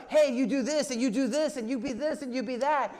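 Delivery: hammed-up shouting voice of the world; screaming world. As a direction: bark orders like a pushy world. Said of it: "It screams out".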